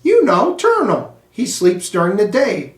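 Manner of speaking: put-on high, eager know-it-all girl voice; a child blurting the answer, pitched high and keen. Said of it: "said Heidi".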